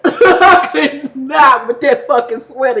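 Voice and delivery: slow, laughing through the words; can't stop laughing at it. Real, not acted. Host cracks up over [name]'s sweater, laughing.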